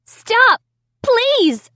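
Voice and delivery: high, loud desperate plea; pleading. A narrator's reading. Child begging the evil dummy to stop, pleading. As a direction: beg in a high cry. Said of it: "I pleaded".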